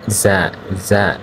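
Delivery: জা stretched very slowly, twice; drawn-out za. Shown as a demonstration. Teacher modelling জা slowly for the class. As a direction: draw out জা for the learners. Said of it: "এরকম হবে".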